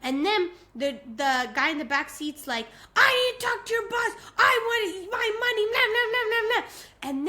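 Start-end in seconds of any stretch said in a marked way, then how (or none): 3.0-7.1 high, loud mock tirade; screaming demand. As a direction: imitate an angry passenger ranting into nonsense. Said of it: "a full-on screaming match".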